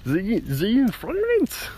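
slow, high, exaggerated German accent. Shown as a demonstration. Podcaster repeating the title for listeners, German accent. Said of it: "you have to say it in a German accent".

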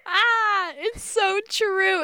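high, slow, hammed-up sigh of agreement; mock lament. Agreeing the mouse owns everything, mock lament. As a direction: wail it like a tragic truth.

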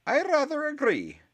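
a stern teacher's icy voice; icy older woman. Acted. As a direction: chill the room with dry authority. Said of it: "said an icy voice, an older woman's voice".

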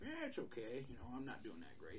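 a hint of a worn-out old man; old-timey voice. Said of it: "good sounding voice for that guy".

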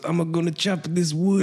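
lightly put-on Spanish accent, ending on a rise; a lighter second try at the Spanish accent; Spanish accent again. Repeating grandpa's line to the co-host, in a Spanish accent. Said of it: "my best, uh, Spanish accent".

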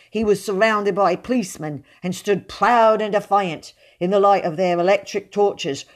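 usual speaking voice

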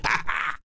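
short high giggle; old man giggling over his false eye; unhinged cackle. Acted. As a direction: cackle madly. Laughs finding his eye.

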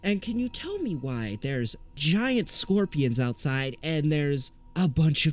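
a nervous man losing his cool composure; alarmed. Acted. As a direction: voice climbing with panic. Panicked Woodrow voice, alarmed.

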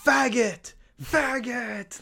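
high, strained mock yell; high-pitched call to someone far away; retold yell. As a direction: imitate yelling at someone from afar. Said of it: "I was just yelling at somebody".

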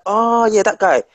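sudden recognition. Retold or imitated. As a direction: act it like the penny just dropped. Acts out viewers suddenly recognising actor.

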